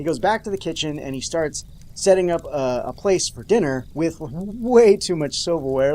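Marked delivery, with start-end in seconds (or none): none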